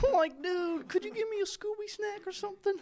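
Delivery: loud, very high cartoon slacker; Shaggy from Scooby-Doo. Greeting Scoob for the co-host, loud high Shaggy voice. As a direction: belt out a high Shaggy impersonation. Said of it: "ones that I'm known for".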